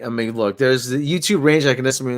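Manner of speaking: ordinary talk, nothing marked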